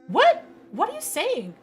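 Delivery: high, loud shocked yelp. Real, not acted. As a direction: jolt up in disbelief. Shocked reaction.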